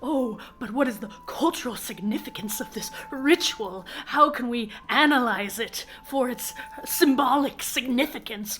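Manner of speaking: mock anthropologist. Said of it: "the filthy anthropologist in the room going".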